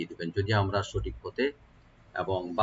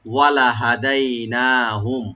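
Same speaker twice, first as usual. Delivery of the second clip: slow, louder drawn ওয়ালা হাদাইনাহুম; recitation demo; 6 dB louder, much slower than usual. Shown as a demonstration. Teacher modelling the verse for students. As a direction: recite the phrase evenly. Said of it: "ওয়ালা লামে এখানে কোনো টান হবে না".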